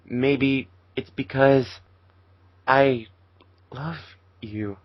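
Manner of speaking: slow, hesitant confession; slowly. Acted. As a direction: draw out the confession, fading to nothing. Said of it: "he said slowly".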